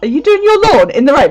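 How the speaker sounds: high, loud disbelieving mum; incredulous mum. Retold or imitated. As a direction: quote mum's shocked question. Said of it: "she was like".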